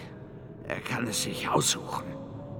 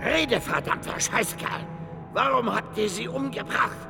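high, forceful angry demand; wütendes Verhör; 11 semitones higher than usual. Acted. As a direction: interrogate him furiously. Brutal interrogation of a captive. (wütend und fordernd)

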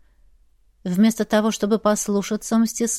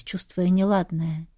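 usual speaking voice

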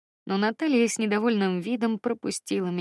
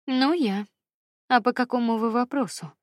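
lofty, superior tone raised a little; haughty; 4 semitones higher, 4 dB louder than usual. A narrator's reading. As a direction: talk down to her, a bit louder. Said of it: "спросила Наталья несколько высокомерно".

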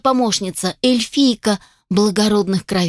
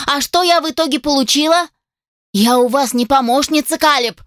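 put-on higher, indignant young woman; angry elf girl; 5 semitones higher than usual. Elf assistant scolds the dragon inventor, higher indignant voice. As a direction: scold him with hurt pride.

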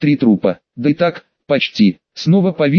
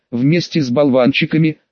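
usual speaking voice

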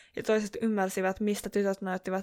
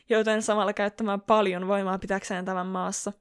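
plain reading voice

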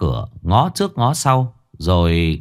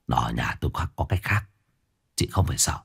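much softer, low man's voice; quiet low man's reassurance; low hushed reassurance; 8 semitones lower, 9 dB quieter, faster than usual. A narrator's reading. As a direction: hush her fears under the breath. Man reassuring her quietly.

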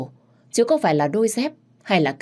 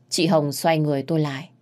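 plain reading voice

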